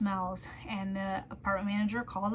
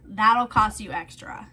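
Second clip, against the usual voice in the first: louder and slow, each word set down; quoted line, louder; 9 dB louder, slower than usual. Retold or imitated. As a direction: deliver the quote loud and deliberate. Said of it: "and I quote".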